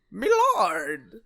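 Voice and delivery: high, loud fussy servant's "Milord"; lightly voiced fawning servant; Jaken impression. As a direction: squeak it like a toady little retainer. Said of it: "then you have this guy".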